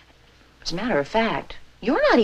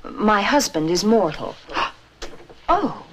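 usual speaking voice

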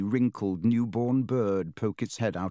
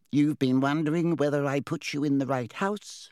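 higher, smartly knowing talking hat; 5 semitones higher than usual. A narrator's reading. Hat guesses Harry's worry about his house, smartly. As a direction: cut in smartly in a small hat voice. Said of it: "said the hat smartly".

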